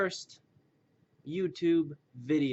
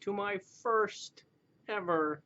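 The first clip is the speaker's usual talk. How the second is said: higher-pitched, drawn-out put-on greeting; grand drawn-out intro voice; announcer-like put-on voice; 7 semitones higher, slower than usual. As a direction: stretch out a mock show-opening hello. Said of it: "Why do I sound like that guy from that thing".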